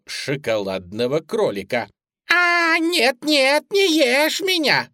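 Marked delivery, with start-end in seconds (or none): 2.3-4.9 very high, loud bunny squeal; squeaky begging, drawn out at the end; thin squeaky voice. A narrator's reading. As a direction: plead in a tiny piping voice. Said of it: "тоненьким писклявым голоском".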